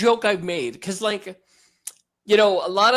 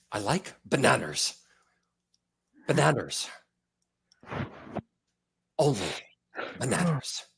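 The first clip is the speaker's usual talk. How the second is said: much lower, quiet, grave voice; low, solemn, measured delivery; Liam Neeson impression; 7 semitones lower, 8 dB quieter than usual. Doing Liam Neeson answering what he eats for breakfast. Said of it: "is Liam Neeson".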